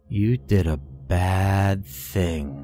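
put-on slow, silky eerie whisper of a boy; a creepy boy leaning close, drawn out slowly; creepy child voice. A narrator's reading. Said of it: "his voice like wet silk".

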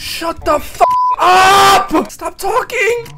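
high, loud, slow drawn-out shout; real angry shouting; furious yell. Real, not acted. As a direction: scream at the voice to stop. Yelling at phone guy.

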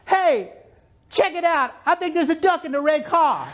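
high, amazed call to coworkers; astonished order taker. Retold or imitated. Worker tells the kitchen about a duck, high astonished voice. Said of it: "I could hear him in the kitchen".

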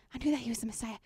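faint hushed repeat. Acted. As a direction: let it fade to a breath. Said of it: "as that Samaritan woman".

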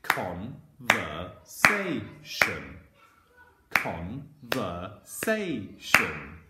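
"conversation" as con, ver, sa, tion with gaps; syllable by syllable. Shown as a demonstration. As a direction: clap out "conversation", very slowly, one syllable per beat. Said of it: "if I do my clap where these apostrophes appear".

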